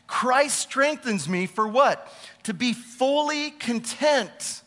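slowed right down, pressing "fully"; slow emphasis. Acted. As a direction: dwell on each word to drive it home.

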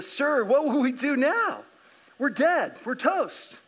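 high, drawn-out mock panic; acted high-pitched alarm; freaking out. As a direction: play a servant sure that all is lost. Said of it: "he freaks out".

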